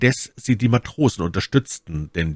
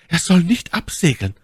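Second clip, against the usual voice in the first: high, louder outcry; Mylady cries out; 11 semitones higher, 4 dB louder than usual. Acted. Mylady forgets herself and cries out, high and louder. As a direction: blurt it out, composure gone. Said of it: "rief Mylady".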